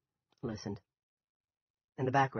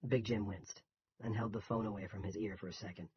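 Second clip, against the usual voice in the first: plain reading voice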